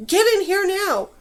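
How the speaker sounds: loud, high furious command; impatient villain's yell; snarling. A narrator's reading. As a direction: order the fools inside angrily. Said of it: "snarled Rakosh".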